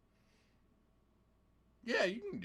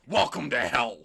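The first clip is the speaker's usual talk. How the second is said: loud villain announcer line; devil Joker announcer voice; 5 dB louder than usual. Acted. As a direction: boom it like a Batman villain. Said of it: "should have been a line in Batman".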